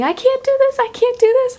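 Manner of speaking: frantic, high-pitched can't-do-this; panicking. Retold or imitated. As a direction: replay the phone call in full panic.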